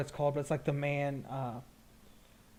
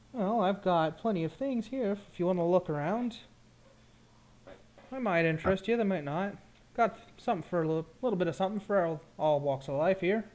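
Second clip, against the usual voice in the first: higher-pitched shopkeeper patter; friendly bauble merchant; 5 semitones higher, faster than usual. Acted. Merchant inviting customer to look around, friendly sales patter. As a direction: coax the customer to browse.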